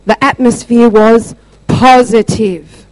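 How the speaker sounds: slow, deliberate restatement in own voice; emphatic retelling. Retold or imitated. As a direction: spell it out slowly, weighting each word. Repeats her answer slowly for emphasis.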